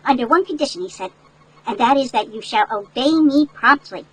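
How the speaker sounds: ordinary delivery, nothing marked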